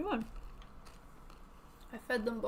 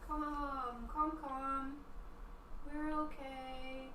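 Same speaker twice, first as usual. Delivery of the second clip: slow gentle coaxing; 3 semitones higher, much slower than usual. Real, not acted. Coaxing sick cat gently.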